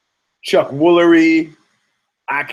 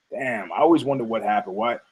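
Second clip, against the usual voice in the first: ordinary delivery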